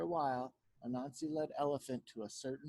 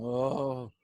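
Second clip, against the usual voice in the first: loud, lower groan; dizzy groan; 4 semitones lower, 8 dB louder than usual. A narrator's reading. Elephant wakes from magic sleep, dizzy groan. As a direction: groan as if head is spinning. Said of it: "His head was spinning".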